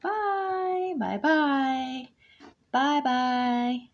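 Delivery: high, calling bear voices; squeaky calling. A narrator's reading. Snow bears calling goodbye to Aluki, high squeaky. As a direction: call goodbye from far away. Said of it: "a high, squeaky voice calling back".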